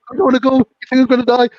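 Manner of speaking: very high, loud imitation of pleading; mock panicked refusal. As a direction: act out a frightened man digging in. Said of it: "they were arguing on the floor".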